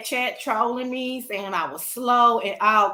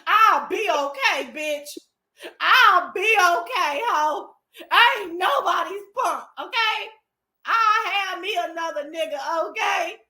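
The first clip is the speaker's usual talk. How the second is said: hammed-up crybaby defiance; tearful mock defiance; 5 semitones higher than usual. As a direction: insist through fake sobs that you're fine. Play-crying defiance after being cheated on.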